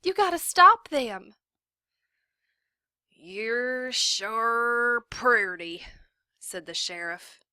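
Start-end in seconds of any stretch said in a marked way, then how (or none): 0.0-1.3 urgent plea. A narrator's reading. 3.1-5.9 very slow, drawn-out leer; leering drawl. A narrator's reading. Drunk sheriff leering.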